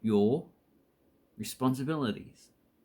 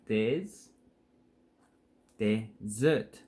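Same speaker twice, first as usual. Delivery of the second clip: desert broken into des- then desert, each part separate; slow split syllables; much slower than usual. Shown as a demonstration. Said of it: "put an emphasis on".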